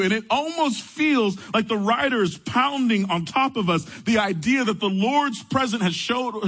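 impassioned preacher driving the point home; preaching. Acted. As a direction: build the sermon with urgency, pitch up. Preaching the story with rising fervor.

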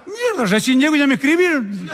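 high-pitched mock indignation; indignant drunk. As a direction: protest in a high, put-upon drunk voice. Drunk protests at the waiter.

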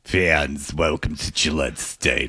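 low mock stage whisper; Harvey Fierstein. Mocking Sarge's attempt to whisper, deep and gravelly. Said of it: "The Harvey Fierstein".

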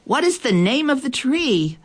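high calling question. A narrator's reading. Animals running toward elephant, calling out high. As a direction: call to her across the plain.

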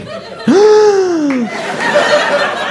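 long, high comic gasp; horrified gasp. Acted. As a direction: gasp in dawning horror. Sudden realization of the caller.

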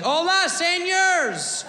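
high, slow mock Spanish greeting; bad Spanish. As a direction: ham up a tourist's Spanish. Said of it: "talking Mexican, like".

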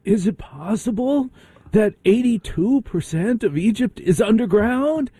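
high-pitched, theatrical wondering questions; David Childress. Retold or imitated. Host mimics a TV researcher for his guest, high-pitched impression. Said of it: "Does David Childress say it like this?".

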